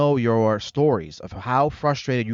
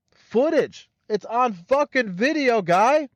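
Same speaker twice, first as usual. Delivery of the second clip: much higher, bursting with disbelief; excited outburst; 10 semitones higher, slower than usual. Real, not acted. Vlogger reacting to the police footage, in excited disbelief. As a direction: shout it like you can't believe it.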